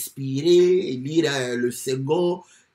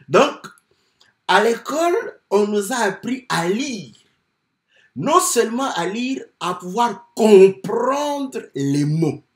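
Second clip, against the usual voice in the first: preaching emphasis; 6 semitones higher, 6 dB louder than usual. Acted. Preaching builds up.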